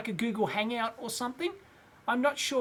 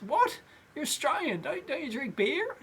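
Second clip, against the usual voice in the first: higher-pitched, incredulous German customs officer; German accent, pitched up in disbelief; 6 semitones higher than usual. Retold or imitated. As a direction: imitate a baffled German official. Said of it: "so in a German accent".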